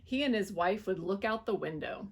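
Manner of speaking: plain reading voice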